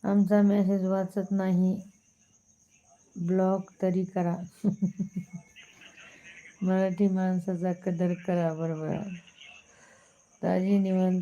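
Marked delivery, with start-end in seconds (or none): 4.4-5.4 short laugh. Real, not acted. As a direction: let out a short run of chuckles. Laughs after block remark.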